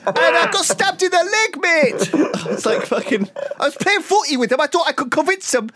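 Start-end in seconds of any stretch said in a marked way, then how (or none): 0.1-2.1 high, exaggerated Geordie-style mock voice; odd put-on accent. Mocking Gazza's accent to the others, high and hammed-up. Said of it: "I don't know what accent this is". 3.6-5.7 high mock Geordie voice, laughing at the end; odd put-on accent. Still impersonating Gazza for friends, high mock accent. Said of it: "I don't know what accent this is".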